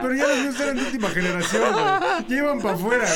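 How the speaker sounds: frat bro. Real, not acted. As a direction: boast with laughter like a bro. Laughing bro boast.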